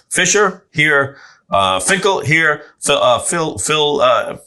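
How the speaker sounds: loud, slow roll call; teacher calling roll. Retold or imitated. Teacher stumbling over the name at roll call, loud and slow. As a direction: read the names off a class list, stumbling. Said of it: "first day of school".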